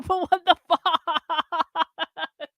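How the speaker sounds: drawn-out words dissolving into laughter; laughing disbelief. Real, not acted. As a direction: stretch the last word slowly into a laugh. Laughing in disbelief at chat prank.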